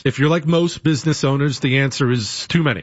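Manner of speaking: upbeat live-read sponsor pitch; brisk radio ad voice. Acted. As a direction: sell it to the listeners. Host reads sponsor ad.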